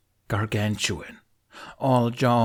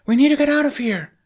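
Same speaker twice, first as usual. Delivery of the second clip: much higher, much louder command; urgent shout; 8 dB louder, faster than usual. A narrator's reading. Astrid ordering the Vikings out, urgent shout. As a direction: yell over the chaos.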